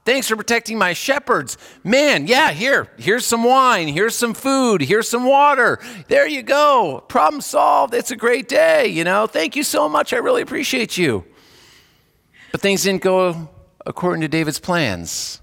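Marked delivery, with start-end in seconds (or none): 0.0-11.2 high, bright, eager thanks; imagined Nabal gushing thanks to David; grateful Nabal. Pretend. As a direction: gush your gratitude to David. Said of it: "thought ... Nabal would be reasonable".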